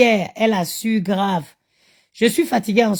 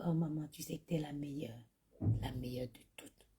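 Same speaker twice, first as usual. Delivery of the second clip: soft, low imitation of a wheedling child; butter Mum up quietly, as her kids do; hushed, low-pitched sweet talk, imitated; coaxing flattery; 6 semitones lower, 20 dB quieter than usual.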